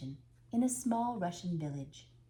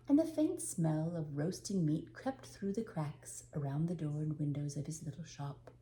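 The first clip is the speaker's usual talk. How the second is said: ordinary delivery, nothing marked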